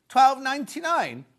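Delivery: thrilled that it's only twelve ninety-nine; excited price reveal. Real, not acted. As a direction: sell the bargain with delight.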